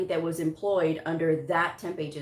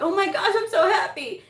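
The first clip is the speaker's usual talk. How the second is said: much higher and louder, overjoyed; excited; 13 semitones higher, 5 dB louder than usual. Retold or imitated. Recruiter thrilled about the new hire, excited. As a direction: gush with joy. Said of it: "she was so excited".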